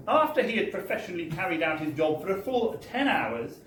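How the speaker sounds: ordinary delivery, nothing marked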